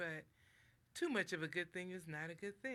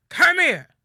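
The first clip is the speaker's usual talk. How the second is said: much louder, higher furious "Come here", falling; angry shout; 11 semitones higher, 27 dB louder than usual. Shown as a demonstration. Angry parent summoning someone, loud shouted command. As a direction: bark it as a threat. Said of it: "But if somebody says to you".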